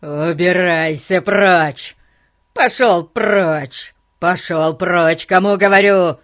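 loud, drawn-out shouts to get away; frantic yelling. Acted. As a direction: yell it in a frenzy, stretching each word. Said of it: "истошные крики".

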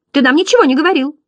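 high, quick clown protest; surprised. A narrator's reading. Other butterflies to the first, high astonished objection. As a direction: blurt it out high and fast. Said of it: "удивились остальные".

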